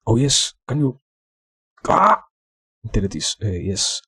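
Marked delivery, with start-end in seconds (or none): 1.9-2.2 short, high loud yelp; startled yelp. Real, not acted. Streamer nearly deleting the whole scene, startled yelp. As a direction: cry out suddenly, high and loud.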